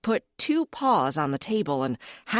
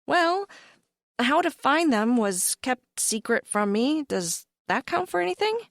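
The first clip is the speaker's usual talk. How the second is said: hopeful pleading; 7 semitones higher than usual. Acted. Narrator character pleads.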